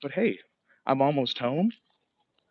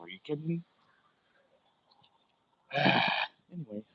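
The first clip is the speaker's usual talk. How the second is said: normal talking voice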